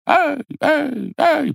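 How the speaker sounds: high-pitched yelps of pain; quick high yelps while fleeing; yelping cries. A narrator's reading. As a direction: cry out "ai" at each sting. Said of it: "покрикивал только дед".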